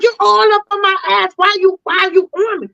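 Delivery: high-pitched defensive confrontation; aggressive, retold. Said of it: "I'm in aggressive mode".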